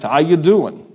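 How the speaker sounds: punchy, high New Jersey greeting; New Jersey accent. Retold or imitated. New Jerseyan greeting a visiting Texas student, New Jersey accent. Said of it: "going to New Jersey and being asked".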